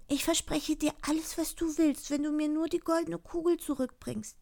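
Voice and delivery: very high princess voice; high-pitched, pleading princess; eager promising princess. Acted.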